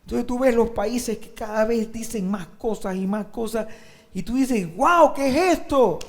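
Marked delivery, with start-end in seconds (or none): 4.7-6.0 high, loud, slow exclamation of shock; shocked disbelief. Acted. Churchgoer seeing what countries now say, shocked. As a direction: exclaim in drawn-out astonishment. Acts shock at worsening laws.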